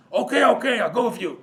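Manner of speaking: high, loud acted giving-in; exasperated agreement. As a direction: burst out as the joke's priest relenting. Joke's priest gives in.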